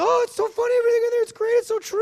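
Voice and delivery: very high, loud gushing; gushing fan. Retold or imitated. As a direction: squeal it like an adoring fan. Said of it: "She's like".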